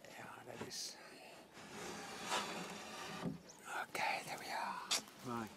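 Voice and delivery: soft unvoiced whisper. Real, not acted. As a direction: whisper so as not to disturb. Hushed near sedated baboons.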